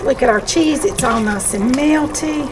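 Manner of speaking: usual speaking voice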